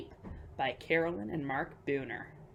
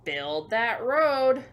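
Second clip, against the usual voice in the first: louder, slow drawn-out command; slow loud call-out; 6 semitones higher, 10 dB louder, much slower than usual. Acted. To the children, a slow, loud construction order. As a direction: bellow it slowly like a site boss.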